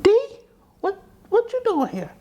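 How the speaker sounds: high-pitched, startled questioning; imitating surprised grandmother. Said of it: "she was like".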